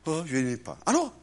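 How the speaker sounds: normal talking voice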